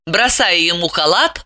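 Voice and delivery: ordinary delivery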